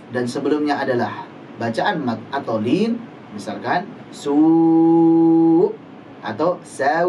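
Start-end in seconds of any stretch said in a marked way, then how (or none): 4.1-7.1 su held very slowly with a firm catch at the end; pressed final hamzah. Shown as a demonstration. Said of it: "harus kita tekan sedikit".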